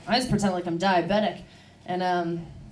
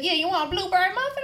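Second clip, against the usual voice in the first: high-pitched server's offer; imitated drive-thru worker; 10 semitones higher than usual. Drive-thru worker to coffee customer, imitated high voice.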